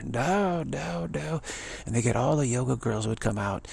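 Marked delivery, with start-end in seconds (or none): 0.0-1.4 higher, pleading no's. Retold or imitated. Devotees pleading with Ma, high pleading no's. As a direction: plead with her to stay. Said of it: "they would plead with her".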